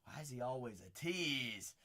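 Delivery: muttered low and quiet to himself; muttered tease. Real, not acted. Card breaker mutters at the pull, quiet and low. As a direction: grumble it under your breath.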